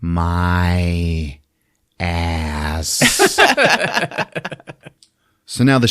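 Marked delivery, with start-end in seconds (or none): 0.0-3.4 low, very drawn-out monster groan; monster in pain. Acted. Monster stunned by the shot, slow low groan. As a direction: stretch each word slowly, with a long pause. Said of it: "he just goes".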